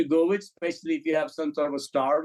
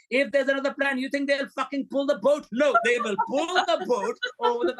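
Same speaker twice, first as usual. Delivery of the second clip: higher, heated, hammed-up retort; mock exasperated insistence; 8 semitones higher than usual. Retelling a filmmaker brushing off investors, mock exasperated. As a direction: play him as stubbornly annoyed, half joking. Herzog retold, insisting on the boat.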